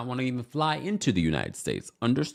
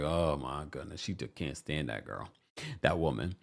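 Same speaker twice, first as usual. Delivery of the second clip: muttered eye-roll disdain; 7 semitones lower, 8 dB quieter than usual. Retold or imitated. Mary Cosby rolling her eyes at Brittany, low grumble. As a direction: lower and much softer, dismissive under the breath. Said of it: "She's just rolling her eyes like".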